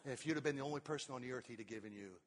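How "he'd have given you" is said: ordinary talk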